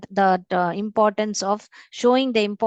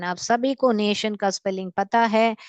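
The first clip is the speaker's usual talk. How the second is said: ordinary talk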